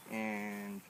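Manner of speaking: ordinary talk, nothing marked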